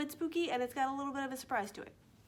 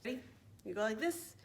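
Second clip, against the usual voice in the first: ordinary delivery, nothing marked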